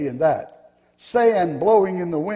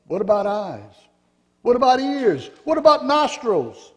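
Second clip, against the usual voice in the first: each question climbing higher and louder; building excited questions; 6 semitones higher, 4 dB louder than usual. Acted. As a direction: Pink pressing harder with every question. Pink's rising questions.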